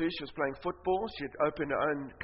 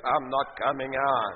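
much lower, slow demonic man's voice; 5 semitones lower, slower than usual. Retold or imitated. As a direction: growl it low and slow like a man inside her. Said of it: "in a man's voice".

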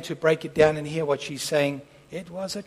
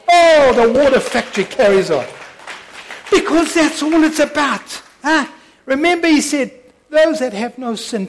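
loud, high, slowed preaching, voice nearly breaking; preaching at full force; 8 semitones higher, 12 dB louder than usual. Acted.

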